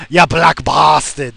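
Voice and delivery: loud, slow mock grumble of an old grandpa; imitating grandfather. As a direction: bark it out like the old man did. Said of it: "That's what he would say".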